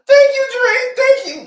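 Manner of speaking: hammed-up tearful gratitude; mock crying thanks. Women thanking [name], high mock sobbing.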